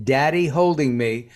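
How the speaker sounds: ordinary talk, nothing marked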